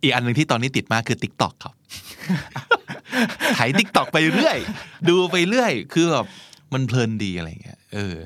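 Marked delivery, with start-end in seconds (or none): 1.8-6.2 giggly, higher voice through laughter; laughing while talking. Real, not acted. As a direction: let the laugh spill into the words. Laughing about scrolling TikTok. (พูดไปหัวเราะไป)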